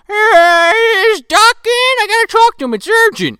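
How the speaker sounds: put-on loud, high drunk phone call; drunk dad. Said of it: "Dad's drunk out of his mind".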